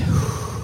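long audible exhale; calming breath. Real, not acted. Speaker reminding himself to breathe mid-story, deliberate exhale. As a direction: let out a slow, steadying breath. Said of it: "remember to breathe".

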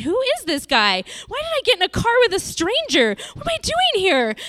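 racing inner panic as it dawns on her; trapped, voicing her own frightened thoughts; panicked thoughts. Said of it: "I'm starting to panic".

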